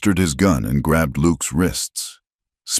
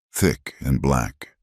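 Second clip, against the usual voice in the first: plain reading voice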